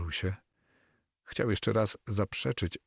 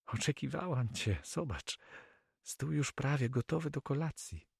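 higher, light female character voice; Flemish girl, welcoming; 8 semitones higher, 5 dB quieter than usual. Acted. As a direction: welcome him in a higher, softer voice. Narrator voices the girl welcoming guest. (młoda dama witająca gościa)

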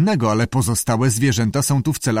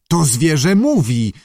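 higher, louder outburst; shocked exclamation; 5 semitones higher, 5 dB louder than usual. A narrator's reading. Director confronts the keeper about the animal, shocked. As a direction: exclaim it in disbelief.

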